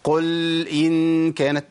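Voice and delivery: "قل إن كانت" with the l of "قل" held far too long; slow chant, overstretched l in "قل"; overlong lam. Shown as a demonstration. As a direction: drag out the lam in "قل" before "إن". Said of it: "لأني زودت الزمن".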